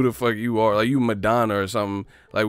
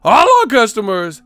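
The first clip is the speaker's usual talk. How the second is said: much higher, loud excited yell; mock eureka; 10 semitones higher, 10 dB louder than usual. Streamer acting out an executive's greedy price idea, excited yell. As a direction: shout like you cracked the code.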